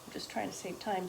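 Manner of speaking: ordinary delivery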